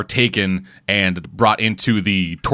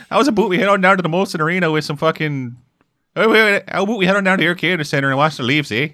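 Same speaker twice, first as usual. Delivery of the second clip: higher, hammed-up Canadian patter; mock Canadian accent; 6 semitones higher, faster than usual. Mocking people's idea of Canadians, silly Canadian accent. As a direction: rattle off cartoon Canadian talk. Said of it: "whenever people are like".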